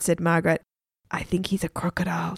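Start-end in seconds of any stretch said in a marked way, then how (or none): 1.1-2.4 soft unvoiced whisper. A narrator's reading. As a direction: breathe it so the guard won't hear. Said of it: "whispered secret to friends".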